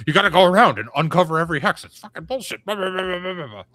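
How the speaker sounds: high, fast angry rant; Joe the Lawyer rant. Retold or imitated. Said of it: "I gotta do my Joe the Lawyer impression".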